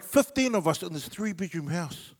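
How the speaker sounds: ordinary delivery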